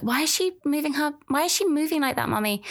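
put-on high, quick child voice asking mummy; little boy's voice. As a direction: play the puzzled little boy, pitched high. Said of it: "And Danny was like".

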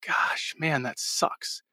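muttered aside to himself, trailing into a whisper; retold self-reproach under the breath; hushed regret. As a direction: drop to a soft, rueful whisper.

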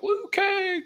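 very high strained cry of a name; desperate yell. Retold or imitated. Kung Lao crying out to Liu Kang while dying, very high cry. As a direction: scream for help as your soul is drained. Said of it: "It's like".

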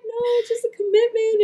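high, laughing through the words; laughing reply. Retold or imitated. Daughter answering mom's teasing, high and laughing. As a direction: answer while giggling at the memory.

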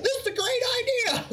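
very high, gushing voice; mock clueless exec. Mocking the executive who pitched it: very high gushing. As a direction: sell it like a clueless boss. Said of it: "who's like".

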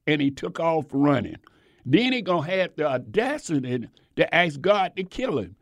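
ordinary delivery

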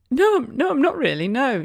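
high, wobbling retold upset; mock whimper. Mum answering a stranger in the queue, mock whimper. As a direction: play up being not okay.

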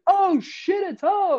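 high, loud mock shriek; starstruck outburst. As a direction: lose it on spotting a bigger star. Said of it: "Like that".

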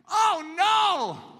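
high shocked cry of dismay; dismayed world. Retold or imitated. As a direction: wail like the disapproving world. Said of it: "what's the world saying?".

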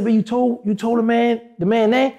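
high, incredulous; shocked accusation. Retold or imitated. To the buddy who named the man, a high, incredulous accusation. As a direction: act stunned he gave the name away. Confronting buddy about naming him.

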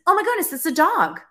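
high, loud burst of discovery; retold astonishment. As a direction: re-enact a sudden startled realization. Retold sudden realization in reading.

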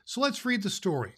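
ordinary delivery, nothing marked

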